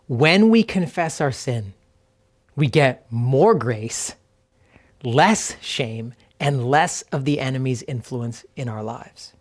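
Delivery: spacious reading with long pauses between phrases; slow reflective reading. Real, not acted. Pastor giving listeners time to remember each truth, slow and reflective. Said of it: "I'm just gonna read them slowly".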